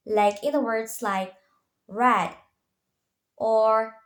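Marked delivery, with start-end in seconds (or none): 1.9-2.3 red with a relaxed English R; red, no roll on the R; untrilled R. Shown as a demonstration. Student teacher showing the English R in red, untrilled R. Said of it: "we pronounce without thrilled or more relaxed".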